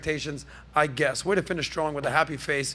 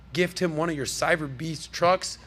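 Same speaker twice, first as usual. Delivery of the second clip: ordinary delivery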